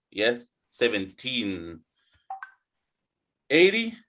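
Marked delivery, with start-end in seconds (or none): 0.1-1.8 put-on seventeen drawn out with the weight on teen; modelling seventeen for the class, slow; slow, stressed teen. Shown as a demonstration. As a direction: say seventeen slowly, leaning on the end. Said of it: "emphasize the E E N".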